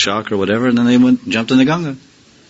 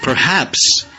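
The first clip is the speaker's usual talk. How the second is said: awed thought; 8 semitones higher, slower than usual. Retold or imitated. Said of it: "he thought".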